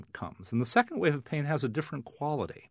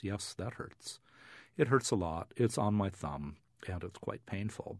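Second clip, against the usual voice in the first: flat voice; 3 semitones lower, 8 dB quieter than usual. Retold or imitated. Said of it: "they'll say in a very flat voice".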